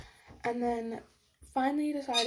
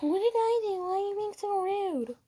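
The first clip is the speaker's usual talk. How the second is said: put-on much higher, whiny girl voice; mock whine; 8 semitones higher than usual. Mocking the girl who plays the victim, whiny. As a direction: mimic her playing innocent.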